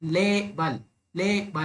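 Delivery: Label in two jaw beats, LAY then falling bul; two-syllable demonstration. Shown as a demonstration. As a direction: show both syllables of label, stress on ले. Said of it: "दो बार मेरी jaw ऐसे ऐसे हो रही है".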